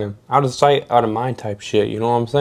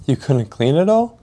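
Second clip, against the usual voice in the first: rising, disbelieving question; incredulous echo; 4 semitones higher, 4 dB louder than usual. Retold or imitated. Worker questions coworker's confession, incredulous echo. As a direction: repeat it back higher, climbing at the end.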